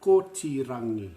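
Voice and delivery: "Kotirangi" said loud and clear, ko-ti-ra-ngi; Māori pronunciation. Shown as a demonstration. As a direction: model "Kotirangi" for the class to echo. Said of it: "say it after me".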